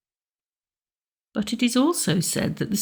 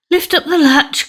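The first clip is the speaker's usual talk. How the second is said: cried out; 8 semitones higher, 4 dB louder than usual. Acted. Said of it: "cried the grandmother".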